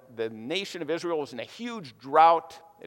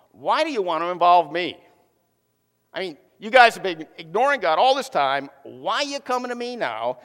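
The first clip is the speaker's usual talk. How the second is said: louder, indignant sarcastic retort; attitude; 3 semitones higher, 6 dB louder than usual. Retold or imitated. Elisha rebuking the king of Israel with attitude. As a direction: throw it back at them, fed up. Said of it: "He gets an attitude".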